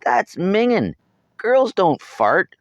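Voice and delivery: put-on high, loud disgusted boy, slow at the end; horrified brother. A narrator's reading. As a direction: drag out the outrage.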